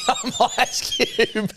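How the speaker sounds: high, drawn-out laughing quote; mocking through laughter. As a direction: repeat their bad impression while cracking up. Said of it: "It's your voice saying".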